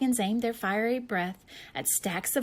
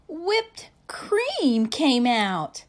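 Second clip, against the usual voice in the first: gasp, then slow louder reveal; surprised reveal; 4 semitones higher, 7 dB louder, much slower than usual. A narrator's reading.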